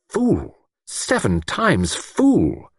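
self-scolding. A narrator's reading. Self-reproach in narration.

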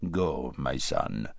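slow, soft regal command; stern old king. Acted. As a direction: speak like a cold, slow emperor. King dismisses his son.

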